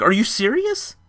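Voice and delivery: high, urgent disbelief; exploding inside. Retold or imitated. Narrator hears his ex is still in town, excited disbelief. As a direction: jump up in pitch like you can't believe it. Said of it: "Inside my head, my, I exploded".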